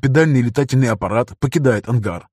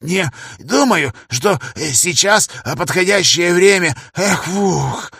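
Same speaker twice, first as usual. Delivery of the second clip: lightly voiced old man, puffing; old man panting between words; strained old professor; 6 semitones higher, slower than usual. Старик-профессор, измотан нагрузкой. (старик, запыхавшись)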